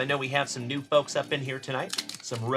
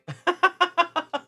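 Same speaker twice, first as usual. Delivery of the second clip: loud high-pitched cackle; laugh; 6 dB louder than usual. Real, not acted. Laughs at Griffey price question.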